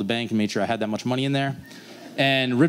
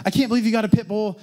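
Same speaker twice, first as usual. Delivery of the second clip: fast, higher imitated upset mom; 8 semitones higher, faster than usual. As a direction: rattle it off like an exasperated mother. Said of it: "she's like".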